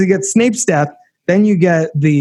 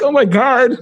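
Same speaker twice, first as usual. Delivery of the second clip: emotional oh my God; 9 semitones higher than usual. Retold or imitated. Host reliving a movie scene, emotional high-pitched gasp. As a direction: voice jumping up, choked with feeling. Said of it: "I'm getting emotional talking about it".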